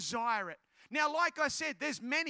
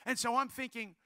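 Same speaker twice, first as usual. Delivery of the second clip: ordinary delivery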